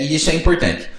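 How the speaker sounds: normal talking voice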